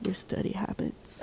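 slow, barely voiced whisper; whispered aside. Real, not acted. Offering a friend a joke answer about studying, whispered. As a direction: slip in a hushed joke answer.

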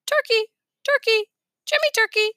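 high taunting parrot squawk. A narrator's reading. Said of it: "parrot teasing".

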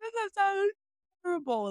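high, whiny wail of woe; mock despair. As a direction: squeal it like the world is ending. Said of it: "and I was like".

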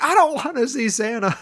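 high whining child impression; hammed-up scared child, high voice; frightened little boy. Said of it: "Billy wakes right up".

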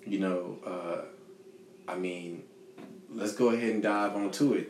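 ordinary talk, nothing marked

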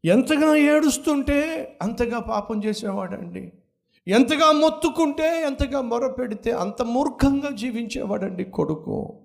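fervent pulpit cry; full-force preaching. Acted. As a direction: preach it out to the congregation. Preaching about the wayward son.

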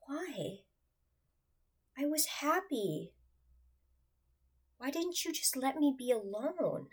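high-pitched, pleading questions to God; retold heartache. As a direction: plead upward in hurt, with long gaps. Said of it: "This was my thinking in my heartache".

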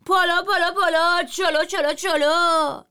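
high, loud chanted calls; hawking shout. A narrator's reading. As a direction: chant the rice calls over the crowd. (فریاد ریتمیک «پالا، چلو»)

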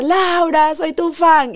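high, excited fan shout of her name; a fan shouting across the street; mock fan yell. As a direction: call it out like a thrilled fan. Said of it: "te voy a gritar".

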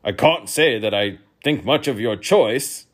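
loud, disapproving royal voice; disapproving king. A narrator's reading. King loudly disapproving of a young guest's food. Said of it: "looking disapprovingly at his plate".

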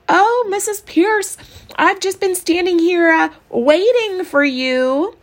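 high, loud, slow put-on innocence; loud fake sweetness. Leslie stalls the teacher in the hall, loud and sugary. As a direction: gush loudly to distract the teacher.

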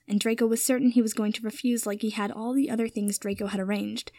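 plain reading voice

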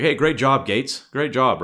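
lightly hammed boss handing out praise; mock Washington praise. Washington congratulating Gates on Arnold's win, loud and cheery.

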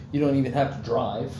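normal talking voice

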